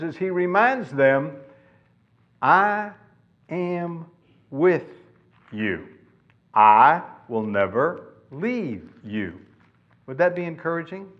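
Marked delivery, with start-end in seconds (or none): 2.4-9.4 slow, word by word; deliberate reassurance. Retold or imitated. God reminds discouraged believers, deliberate and spaced out. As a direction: set each word apart with a pause.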